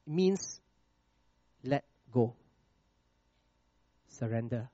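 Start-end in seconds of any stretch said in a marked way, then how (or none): none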